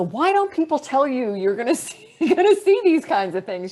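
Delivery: retold astonishment. Astronaut retelling her thought after the shooting star, astonished. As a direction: recount your amazed disbelief, pitch well up. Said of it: "I remember floating off thinking".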